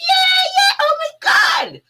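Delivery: high-pitched mock cheer, oh my God; mock celebration. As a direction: gush with fake delight at the news. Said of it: "we're supposed to be like".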